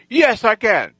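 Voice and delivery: high, emphatic comeback; imitating an angered gang leader; acted defiant boast; defiant retort. Gang leader's angry boast back.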